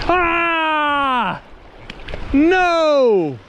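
sharp high cry of dismay; loud dismayed shout. Real, not acted. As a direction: yell out in frustration. Fish lost, cries out.

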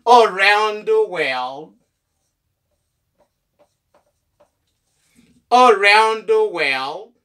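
slow "Around the world", opening on uh; reduced A. Shown as a demonstration. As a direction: spell out "Around the world" slowly, uh-round first. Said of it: "ah, around". ("Around the world" dousman, ak son "ah" devan)

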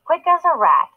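ordinary delivery, nothing marked

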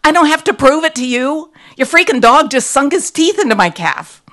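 loud, high indignant outburst; outraged exclamation. A narrator's reading. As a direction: explode at the dog's owner, propriety gone. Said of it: "I exclaimed".

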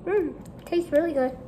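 talking while eating; chewing. Real, not acted. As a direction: mouth partly full. Seen 2: Woman bit candy just before; lips closed, chewing while speaking.

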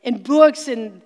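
rally the congregation to take back the land; full-force revival preaching; fiery preaching. Acted.

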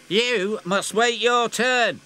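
slow, high, cross engine. Acted. James scolds Emily, slow and cross. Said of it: "said James crossly".

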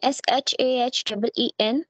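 usual speaking voice

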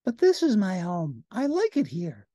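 put-on high childlike Big Bird voice; sing-song big yellow bird, high and innocent; high, sweet, earnest giant bird. A narrator's reading. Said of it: "said Big Bird".

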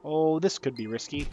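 ordinary talk

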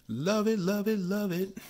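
high-pitched, repeated cooing delight. Real, not acted. Collector thrilled by a numbered card, delighted.

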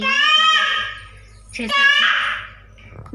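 very high, loud, slowly stretched "मतलब"; stretched shout. Real, not acted. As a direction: call it out long and loud. Calling out over baby. (लंबा खींचकर ऊँची आवाज़ में पुकारना)

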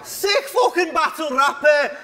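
high, hammed-up Scouse shout; mocking Scouse accent. Battle rapper mocks his Scouse rival, loud exaggerated Scouse accent. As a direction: mimic a loud Scouser, stretched and high-pitched. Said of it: "The Scouse accent is loud and intrusive".

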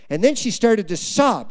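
ordinary talk

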